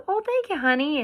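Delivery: high, sweet lady's voice; a Southern lady thanking her kindly; lightly voiced kind older woman. Woman's reply voiced in story.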